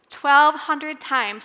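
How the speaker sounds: twelve hundred times dragged out slowly and loudly; slow emphatic repeat. Shown as a demonstration. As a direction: lean on every word of twelve hundred times. Preacher returns to the number.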